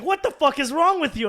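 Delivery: high, loud mock outrage; scolding mom. Friend's mom seeing panicking teen, scolding. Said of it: "And she's like".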